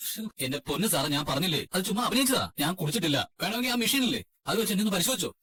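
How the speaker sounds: high-pitched, insistent protest of innocence; protesting to an officer, voice pushed high; exasperated pleading. Acted.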